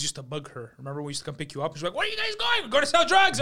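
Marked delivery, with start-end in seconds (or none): 1.9-3.4 high, loud mock yelling; playful high shout; imitating shouted exchange. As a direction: call it out as if to a balcony. Retold shouting exchange with mother.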